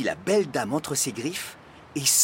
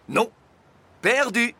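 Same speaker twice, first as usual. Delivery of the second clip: triumphant answer; 10 semitones higher, 5 dB louder than usual. A narrator's reading. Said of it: "répondit le facteur".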